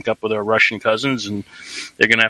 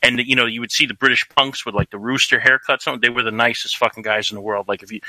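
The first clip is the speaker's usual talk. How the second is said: usual speaking voice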